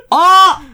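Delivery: high, loud yelp of protest; startled cry. Real, not acted. Woman hit by a colleague mid-argument, startled cry. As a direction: cry out as if just struck.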